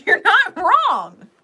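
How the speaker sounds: high, loud and slow with a laugh; shouted insistence. Real, not acted. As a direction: belt out "not wrong", drawn out. Shouted reassurance, repeated later softly.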